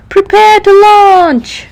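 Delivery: high, loud, slow call; called over speakers. Acted. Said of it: "Dashi called over the speakers".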